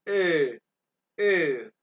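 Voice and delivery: ए said loud and held as the German umlaut vowel; umlaut sound. Shown as a demonstration. Teacher demonstrating the umlaut sound for students. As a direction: repeat ए clearly three times as the umlaut vowel. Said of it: "और इसको कैसे करते हैं?".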